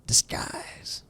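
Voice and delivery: hushed secret whisper. Acted. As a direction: breathe the word like a secret.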